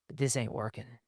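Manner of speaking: quiet, understated admission; subdued husband. Acted. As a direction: say it quietly, backing off. Models a measured husband's line.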